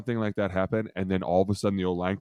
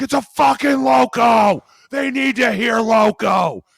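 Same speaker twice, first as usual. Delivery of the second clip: much higher, loud, slow shout; mock yelling; 13 semitones higher, 11 dB louder, slower than usual. Player shouting the call so the defense hears it. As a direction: bellow the call across the field.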